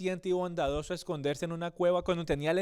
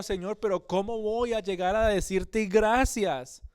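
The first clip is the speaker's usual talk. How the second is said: higher and louder, a pleading, near-tearful cry to God; anguished plea; 5 semitones higher, 5 dB louder than usual. Retold or imitated. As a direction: cry out to God as a hunted man at the end of his rope. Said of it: "llorando, incluso angustiado". (súplica angustiada, más aguda y fuerte, casi llorando)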